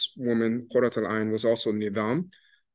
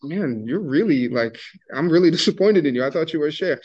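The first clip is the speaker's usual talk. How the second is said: mock disappointment; 6 semitones higher, 6 dB louder than usual. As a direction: tease him like a cheeky girl let down by a sheikh. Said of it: "she's like".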